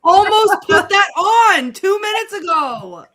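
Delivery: high, loud exclamation; real, amused near-shout of surprise; excited outburst. Real, not acted. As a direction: blurt it out at a high-pitched shout. Excited reaction to sarcastic joke.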